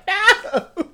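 high mock wail; hammed-up embarrassment; retold mortified no. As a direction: cry it out in playful horror. Reliving secondhand embarrassment.

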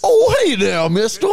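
high, put-on caricature voice; that exact voice. Friends all do the same joke voice, high put-on. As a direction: pitch way up into the joke voice. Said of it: "Everybody's doing that exact voice".

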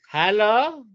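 high, loud innocent hello; mock innocent mother; mimicking the mother. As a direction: play innocent on the phone. Mother answering phone, voiced.

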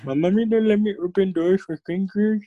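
put-on little-kid voice. Mocking little-kid excuse.

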